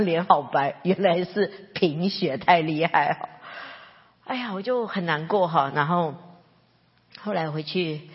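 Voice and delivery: ordinary talk, nothing marked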